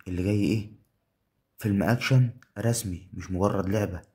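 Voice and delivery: loud, excited commentary; hyped. Real, not acted. Streamer hyping the win to viewers, loud and excited. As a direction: sell the moment big.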